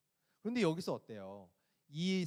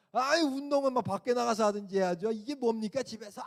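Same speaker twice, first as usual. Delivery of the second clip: a loud, higher mock telling-off; retold angry snapping; 7 semitones higher, 8 dB louder than usual. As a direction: scold the neighbour again, loud and pushy. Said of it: "막 쏘아붙였어요". (큰 소리로 쏘아붙이는 흉내)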